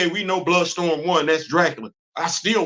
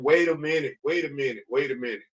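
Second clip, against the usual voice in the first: mock realization trailing off softer; fading to quiet; 5 semitones lower, 6 dB quieter than usual. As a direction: wait a minute, fading away. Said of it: "I sat back and I said".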